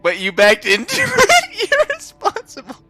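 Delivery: high, loud, laughing through the quote; can barely get the managers' line out for laughing; laughing mock managers. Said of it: "they were like".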